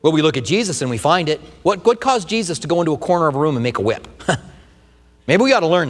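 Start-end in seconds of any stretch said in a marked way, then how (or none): none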